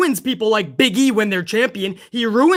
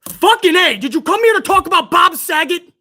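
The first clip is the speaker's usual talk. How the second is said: loud, higher fed-up rant; angry outburst; 5 semitones higher, 4 dB louder than usual. Real, not acted. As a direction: demand an answer, exasperated. Ranting at chat.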